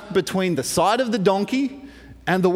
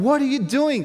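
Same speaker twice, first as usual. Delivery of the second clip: higher-pitched mock scolding; acted exasperation, pitched up; cursing; 6 semitones higher than usual. Balaam cursing the donkey that lay down, mock furious. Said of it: "just starts cursing at the donkey".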